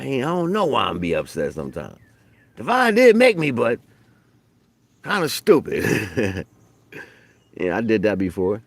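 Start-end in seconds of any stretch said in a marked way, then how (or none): 2.5-3.8 much higher, louder squeak; a high comic boast; mock proud. Teasing himself about his ego, high mock pride. Self-mocking exclamation. 5.0-6.4 slow, drawn-out punchline; slow deadpan. Acted. Mocking himself after boasting, slow deadpan.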